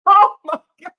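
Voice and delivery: high, loud laughing oh my God; cracking up in disbelief. Real, not acted. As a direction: laugh it out, overwhelmed. Laughing at incriminating comment.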